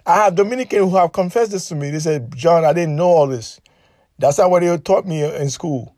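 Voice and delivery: ordinary talk, nothing marked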